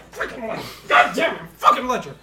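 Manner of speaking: loud high frustrated swearing; cursing elf. Acted. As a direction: curse at the dirt.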